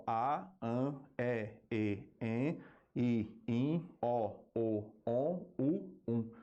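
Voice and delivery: A, An, E, E, En said as separate open, closed and nasal vowels; vowel phoneme demo. Shown as a demonstration. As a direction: sound out each vowel alone from A to Um, oral then nasal. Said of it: "a gente tem doze em língua portuguesa". (vogais isoladas, orais e nasais, de A a Um)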